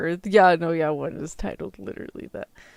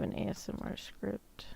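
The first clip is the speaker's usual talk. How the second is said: whisper; 2 semitones higher, 8 dB quieter than usual. Real, not acted. Reads ASMR script announcement.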